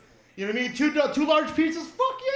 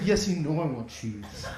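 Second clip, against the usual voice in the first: lower, softer, greedy reply; low eager cheese voice; 11 semitones lower, 5 dB quieter than usual. Retold or imitated. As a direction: murmur it like you can't wait.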